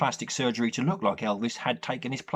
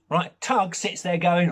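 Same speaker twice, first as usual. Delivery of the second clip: ordinary talk, nothing marked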